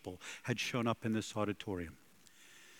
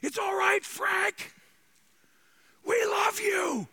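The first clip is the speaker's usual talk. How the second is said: much higher, loud shout across a hall; shouted from balcony; 6 dB louder, slower than usual. Retold or imitated. Preacher retelling a fan shouting comfort to [name] on stage, loud high shout. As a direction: call out to him from the balcony, full voice. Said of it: "shouted these words".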